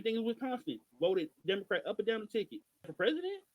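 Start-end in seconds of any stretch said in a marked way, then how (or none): 2.9-3.4 "For president?" jumps much higher with a rising tune; incredulous question. Real, not acted. Host doubting the election results, incredulous. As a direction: ask it like you can't believe it.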